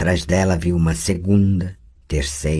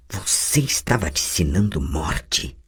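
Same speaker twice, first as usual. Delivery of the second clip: ordinary talk, nothing marked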